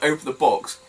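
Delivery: ordinary talk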